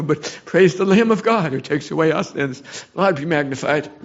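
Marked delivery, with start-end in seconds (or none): none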